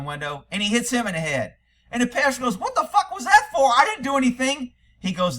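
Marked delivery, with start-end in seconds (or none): 2.6-4.7 high, loud, hammed-up bewilderment; mock indignant protest. Joke passenger hit by cop for nothing, indignant. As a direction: protest your innocence in a comic high voice.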